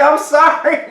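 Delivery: high, loud giggling sorry through real laughter; laughing apology. Real, not acted. To the clerk he beat to the album, laughing sorry. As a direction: apologize while cracking up. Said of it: "I started laughing".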